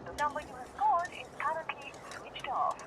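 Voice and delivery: whisper. Acted. Same message fading to whisper.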